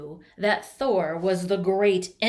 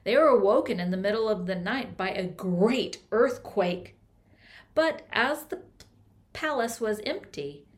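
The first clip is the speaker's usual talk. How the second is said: plain reading voice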